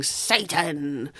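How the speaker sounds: normal talking voice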